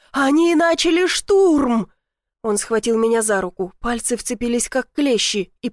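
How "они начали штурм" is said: громкий панический крик, медленно, выше; кричи в страхе, растягивая «штурм»; паника. A narrator's reading. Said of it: "в его голосе была паника". (крик паники)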